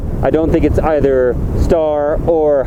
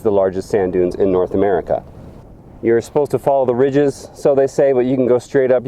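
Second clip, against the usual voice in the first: ordinary delivery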